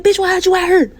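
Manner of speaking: high, shouted demand; shrill mock yell. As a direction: yell it in disbelief while running. She shouts while fleeing.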